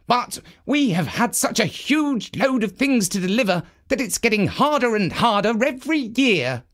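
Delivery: the steward sighing about the workload; Dusty the steward. A narrator's reading. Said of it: "he said".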